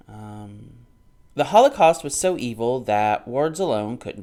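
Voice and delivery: loud, earnest reading voice; book read-aloud. Acted. Vlogger reads the chapter's final section to viewers, in a loud reading voice. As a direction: read the Holocaust passage out steadily.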